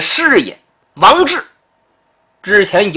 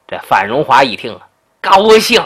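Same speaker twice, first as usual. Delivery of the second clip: plain reading voice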